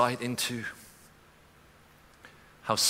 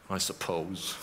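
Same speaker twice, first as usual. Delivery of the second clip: grudging, quiet concession; a touch of reluctant grudging; begrudging; 4 semitones lower, 5 dB quieter than usual. Retold or imitated. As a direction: concede it reluctantly under the breath. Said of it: "it's like begrudging".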